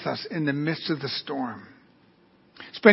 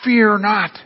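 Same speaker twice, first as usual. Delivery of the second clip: a firm, raised call to a crowd; mild shout; 4 semitones higher than usual. Acted. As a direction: call it out, higher but short of yelling. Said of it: "he had to shout them".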